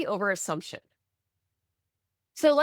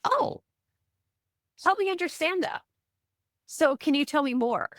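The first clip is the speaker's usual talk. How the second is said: high, open curious 'oh', easing down; 5 semitones higher than usual. Acted. Coach models answering criticism with curiosity, curious and higher-pitched. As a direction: ask with curiosity, not assumption. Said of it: "you could choose curiosity over assumption".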